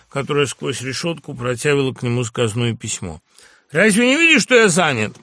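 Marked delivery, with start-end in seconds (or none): 3.7-5.2 loud, high irritated question; shouted rebuke. A narrator's reading. Postmaster busy, to a waiting woman, shouted rebuke. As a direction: scold impatiently at full voice. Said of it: "крикнул он бабе".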